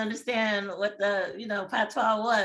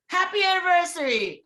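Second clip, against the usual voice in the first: mock bubbly waitress, higher and louder; cheery waitress; 8 semitones higher, 7 dB louder than usual. The waitress greets the couple, bright, high and loud. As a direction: sing it out like an oblivious server.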